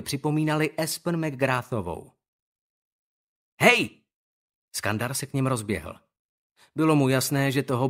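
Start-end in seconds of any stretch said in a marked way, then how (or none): 3.6-4.0 loud high shout; calling out. Acted. Skandar shouts at the bullies to stop. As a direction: yell to stop them.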